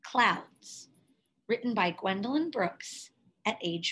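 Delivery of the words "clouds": ordinary delivery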